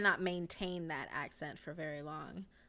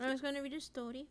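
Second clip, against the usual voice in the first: quick, higher Romanian-ish accent; vaguely Romanian accent; 5 semitones higher, 5 dB quieter, faster than usual. Shown as a demonstration. Streamer repeating their weird accent, quick Romanian-ish delivery. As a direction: rattle it off in a comic Romanian accent. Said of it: "it's, like, Romanian".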